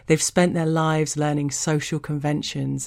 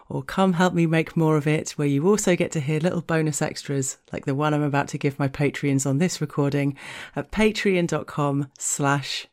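usual speaking voice

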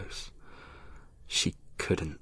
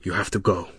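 a rough, hoarse warning, louder; low and hoarse; 5 dB louder, faster than usual. A narrator's reading. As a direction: push the words out rough and grave. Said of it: "voice low and hoarse".

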